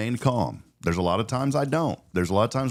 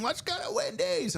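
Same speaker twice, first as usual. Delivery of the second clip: mock child scream; 7 dB quieter than usual. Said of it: "she was screaming".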